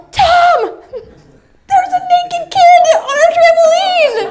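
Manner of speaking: high laughing alarm, calling for Tom; half-laughing panic, shout to husband; panicked call. Retold or imitated. Mother calls husband about naked kid, high laughing panic.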